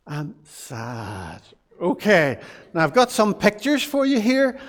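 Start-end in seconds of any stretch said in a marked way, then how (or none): 0.1-1.4 low, soft sad voice. Shown as a demonstration. Showing the children how sad sounds, low and soft. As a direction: say it quietly and low, downcast. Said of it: "adults, let's try it".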